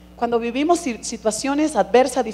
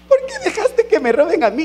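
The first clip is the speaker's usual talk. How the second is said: riendo; 6 semitones higher, 5 dB louder than usual. Retold or imitated. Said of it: "cómo me da chiste".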